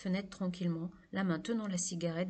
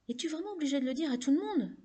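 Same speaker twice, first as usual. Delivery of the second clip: higher-pitched, cutting reproach; 8 semitones higher, 3 dB louder, faster than usual. A narrator's reading. Laurie rebukes David for telling everyone, scathing. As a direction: demand it in a scathing, raised pitch. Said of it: "dit Laurie d'un ton sanglant".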